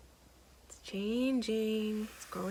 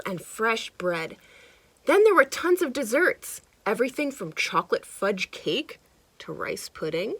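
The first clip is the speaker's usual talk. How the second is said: lively storybook narration; animated read-aloud for kids; reading to children; 9 dB louder than usual. A narrator's reading. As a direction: read it like a picture book to a child. Reading picture book aloud.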